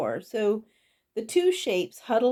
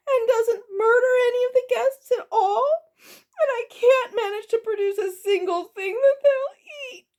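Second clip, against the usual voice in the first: high, tearful lament building louder; crying goblin cook; 12 semitones higher, 6 dB louder than usual. A narrator's reading. Goblin cook mourns her one big chance, crying out. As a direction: wail despairingly, rising to a loud peak. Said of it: "cried Orla".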